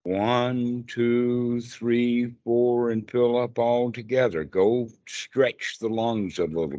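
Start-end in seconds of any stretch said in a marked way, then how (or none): 0.0-4.4 firm, evenly spaced slow count. Shown as a demonstration. Teacher showing a doubting student a long breath, counting slowly. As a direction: stretch each number to lengthen the breath. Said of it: "Breathe slowly, but long".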